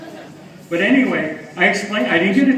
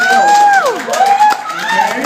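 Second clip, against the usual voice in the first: loud call; 9 dB louder than usual. Real, not acted. Rallying the crowd.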